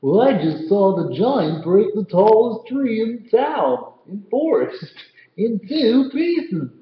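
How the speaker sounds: a frightened tall man crying out his news; storyteller voicing a scared Tall Tom; Tall Tom's voice. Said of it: "what's Tall Tom sound like".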